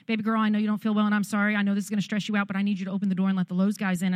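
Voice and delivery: fast, urgent and pleading; rushed apology. Retold or imitated. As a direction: race through the orders to the daughter. Frantic phone call to daughter.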